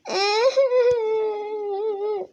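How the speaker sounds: mock wailing sob; fake crying. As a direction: whine as if crying to get mum. Younger girl fake-cries.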